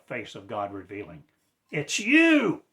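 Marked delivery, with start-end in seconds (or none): 1.7-2.6 high, loud cry of accusation; shouted accusation at the listener; sudden shout. Real, not acted. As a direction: fling it out as a shout. Sudden accusation mid-sermon.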